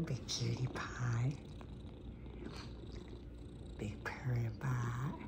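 slow whisper. Real, not acted. Owner cooing to her purring cat, slow whisper. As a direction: coo it in a whisper, slowly.

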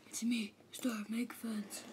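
hushed whisper. Acted. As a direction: breathe it out unvoiced. Whispered phone caller.

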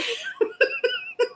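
a very high, soft squeal of laughter; helpless squealing giggle. Real, not acted. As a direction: shriek with laughter at the joke. Laughs at glúteos comment.

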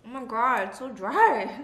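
chewing while talking; speaking between bites; mouth full. Real, not acted. Seen 3: bite taken at -1, lips working and grimacing during words.

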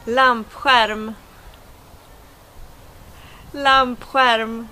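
lampskärm with the Swedish sj-sound for sk. Shown as a demonstration. Teacher showing viewers the word lampskärm, Swedish pronunciation. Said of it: "words that I should, uh, pronounce".

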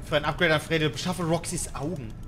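normal talking voice

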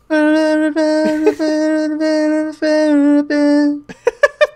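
loud high laughing burst; big laugh. Real, not acted. Laughing after botched singing.

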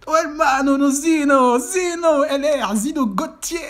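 roaring the player's name high and loud; excited goal shout. Real, not acted. Commentator yelling as his player scores, excited roar. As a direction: scream the name as the ball goes in.

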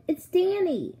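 high-pitched call; shouting. A narrator's reading. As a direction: call out your name to be found. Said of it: "I shouted".

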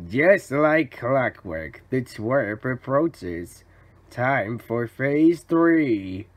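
slow, low plotting; scheming villain. Acted. Villain as the twerp approaches, slowly scheming. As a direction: savor each step of the plan, slowly.

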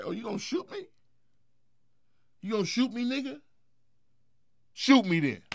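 high, loud mock-incredulous challenge; retold defiant dare. Man retelling how he dared a rival holding a gun, defiant.

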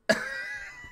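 high wheezing laugh; real squeaky fit of laughter; dying laughing. Real, not acted. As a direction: crack up helplessly, way up high. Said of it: "I was dying".

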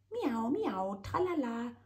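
high singsong teasing meow. A narrator's reading. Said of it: "stichelt der Winzling".

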